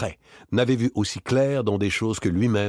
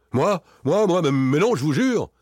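high, louder, stammering protest; startled denial; 10 semitones higher, 6 dB louder than usual. A narrator's reading. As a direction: blurt it out, tripping over the first words. Startled man denies being recognized.

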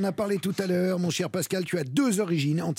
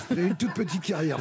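laughing. Real, not acted. Laughing at his own joke.

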